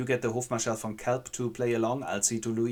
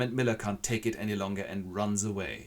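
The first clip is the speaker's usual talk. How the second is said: ordinary talk, nothing marked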